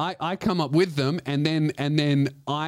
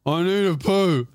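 mock slurred childish whine, a bit louder; childish plea; 4 dB louder than usual.

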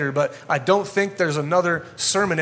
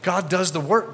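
ordinary talk, nothing marked

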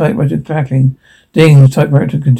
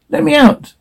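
very high, thin-volume plea; desperate begging; 11 semitones higher than usual. Acted. Trapped character begs for release, desperate begging. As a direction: beg to be freed, pitch shooting up.

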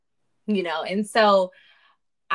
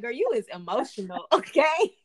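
teasing herself, laughing; mock scolding with a laugh; sassy self-talk; 4 semitones higher, 5 dB louder than usual. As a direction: call yourself out, girlfriend style. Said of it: "I'm like".

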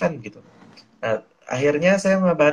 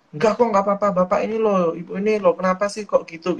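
higher-pitched, exasperated protest; annoyed protest; 6 semitones higher than usual. Acted. Grown child objecting to worried parents' fussing, annoyed. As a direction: push back at being fussed over. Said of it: "terus kita nggak suka nih dicemasin".

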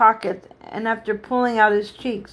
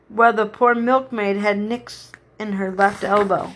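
plain reading voice

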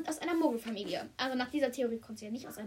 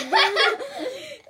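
high burst of laughter; loud laugh; 11 semitones higher, 10 dB louder than usual. Real, not acted. Laughs at friend's remark.

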